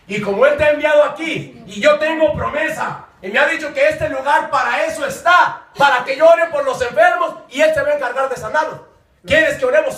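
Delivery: fervent preaching. Retold or imitated. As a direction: proclaim the promise with conviction. Preaches his retold reply.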